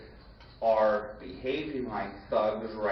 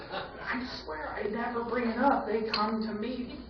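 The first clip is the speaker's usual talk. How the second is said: whiny plea; 12 semitones higher than usual. Retold or imitated. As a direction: whine like a wrongly accused kid. Pleading innocence, falsetto.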